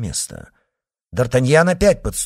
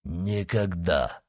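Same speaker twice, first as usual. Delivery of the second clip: low, slow, softer echo of Никогда; deeper, slower echo; Porthos repeating; 4 semitones lower, 5 dB quieter, much slower than usual. Acted. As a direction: rumble Никогда slowly beneath the cry. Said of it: "повторил Портос".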